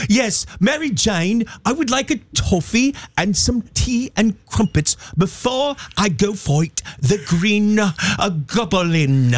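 high, slow, hammed-up British accent; British Spider-Man. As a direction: order tea like a cartoon Brit superhero. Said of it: "if Spider-Man talked like that".

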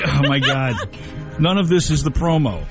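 ordinary talk, nothing marked

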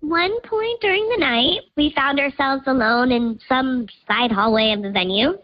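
digitally disguised voice; pitch-shifted anonymous voice; voice changer. Real, not acted. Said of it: "'cause Voice Changer's on".

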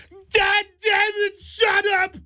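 high, slow strained mock yell; a retold teacher's scream, high-pitched and dragged out. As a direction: bellow it like a fed-up teacher. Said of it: "when that teacher yelled".

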